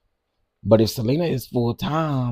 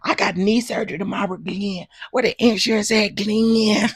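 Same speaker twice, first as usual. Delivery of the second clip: high, fast whining plea; wife whining to Glenn again; mock whine; 9 semitones higher than usual. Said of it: "That's how she said it".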